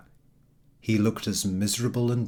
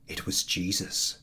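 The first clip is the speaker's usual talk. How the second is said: ordinary delivery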